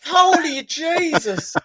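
high, laughing exasperated rant; mock fury. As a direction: explode in laughing mock rage. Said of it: "He's seething".

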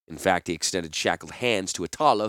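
ordinary delivery

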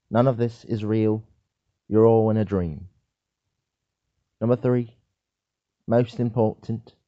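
loud, emphatic presenter laying out each point; mock lecturer. Lecturer addresses an audience on very important things, mock-serious.

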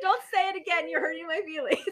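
high, playful wounded plea; mock hurt. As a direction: act hurt while holding back laughter. Joking mock hurt amid shared laughter.

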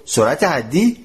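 ordinary delivery

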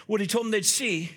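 ordinary talk, nothing marked

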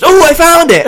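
high, loud triumphant yell; hammed-up excited shout; Paul's excited find. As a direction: imitate a friend crowing over his discovery. Acts Paul finding registration too late.

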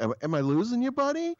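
mock pet-talk, soaring upward. Clueless partner to a grieving client, high mock pet-talk. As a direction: mimic coddling, squeak up high on "buddy". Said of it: "talking to people like they're, like they're pets".

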